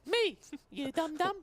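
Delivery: high, slow squeaky taunt. Pretend. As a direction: tease him like a cartoon imp. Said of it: "I'll be this voice".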